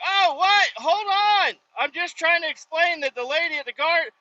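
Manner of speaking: high-pitched panicked protest, hammed up; retold freaking out. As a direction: plead your case in a mock panic. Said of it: "I'm, like, freaking out".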